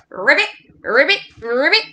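rolled, rising ribbits; Mister Frog. Acted. Jumping like Mister Frog with the children, frog croaks. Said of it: "be like Mister Frog".

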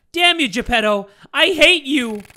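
loud, high-pitched cursing; hammed-up enraged heroine; furious acted shout. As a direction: scream hatred at the villain. Mia curses Geppetto.

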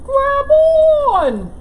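loud urging call; bear calling grab on. Acted. Sun bear calling to critters to grab on. Bear lowers branch again.

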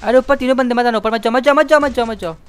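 real alarm call in the heat of a game; urgent warning. Real, not acted.

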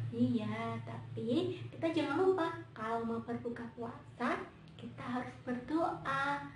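usual speaking voice

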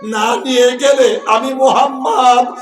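chanted sermon with long-held line endings; melodic preaching. Acted. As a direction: preach it in a loud, sustained sing-song. সুর করে আবেগী ওয়াজ. (সুরেলা ওয়াজ)

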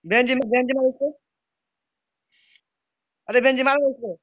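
loud, high yell at a teammate; urgent shout. Real, not acted. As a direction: bark the order to attack. Shouting at teammate mid-fight. (Benz को ज़ोर से चिल्लाकर हमला करने को कहना)

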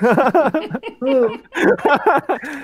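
long, high laugh; real burst of laughter. Real, not acted. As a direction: crack up at a friend's story. (kahkaha atarak)

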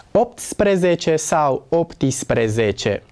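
ordinary delivery

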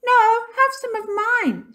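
put-on very high, loud child's yell; eager shout. A narrator's reading. Minx offering cake to burglar, shouted high and eager. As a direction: shout over the other child. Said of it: "shouted Minx".

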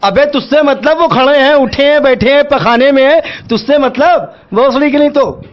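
angry retort. Real, not acted. Shopkeeper explodes at the nosy repeated question, angry. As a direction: tell the caller to mind his own business, heatedly.

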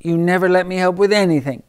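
high, fast, put-upon duck voice; huffy duck. Acted. The duck protests to his friends in a high huffy voice. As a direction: duck grumbling that nobody lets him help.

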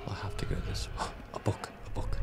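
fast hushed whisper. Real, not acted. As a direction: whisper quickly so nothing hears. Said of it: "talking closer to the mic while whispering".

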